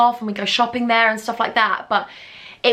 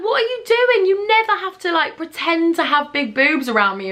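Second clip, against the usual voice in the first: mock incredulous boyfriend; 7 semitones higher than usual. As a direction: squeal it like a scandalised boyfriend. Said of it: "He was like".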